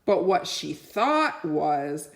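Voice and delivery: plain reading voice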